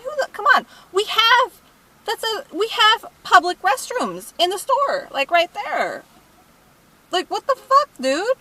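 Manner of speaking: high-pitched, incredulous venting; real exasperated disbelief. Real, not acted. Exasperated at the customer's behavior.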